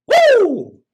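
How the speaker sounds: high, loud whoop of delight; cheer. Real, not acted. Speaker cheers for his own idea, a loud whoop. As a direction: let out a big happy woo.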